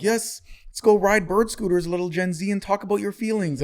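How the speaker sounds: mocking adults. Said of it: "the adults are like".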